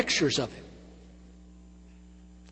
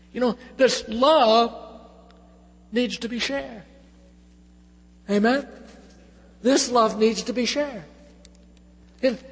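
preaching at full force; 7 semitones higher, 3 dB louder than usual. Acted. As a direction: drive each line home from the pulpit. Closing exhortation.

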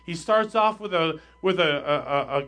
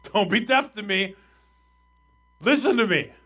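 raised, insistent plea to be heard; pleading; 4 semitones higher, 4 dB louder than usual. Retold or imitated. Psalmist begging God not to be deaf, pleading. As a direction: plead urgently, pitched up. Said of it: "plead with God to hear us like that".

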